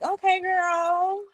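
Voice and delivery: put-on high, drawn-out sassy okay girl; sassy approval. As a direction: stretch it out, impressed and sassy. Reacting to narrator's accent.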